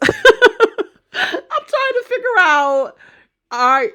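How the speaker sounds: high-pitched, slowed words through real laughter; laughing. Real, not acted. Speaker baffled by what she has been discussing, laughing as she talks. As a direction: let laughter take over the words. Laughing in disbelief while commenting.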